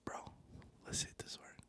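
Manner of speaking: breathy whisper into the phone. Real, not acted. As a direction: hush it right down, barely voiced. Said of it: "Why are you whispering?".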